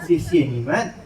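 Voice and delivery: usual speaking voice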